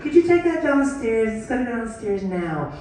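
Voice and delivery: honeyed but firm request; mock sugary wife giving orders; sweet wife voice. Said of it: "A woman can make a man do".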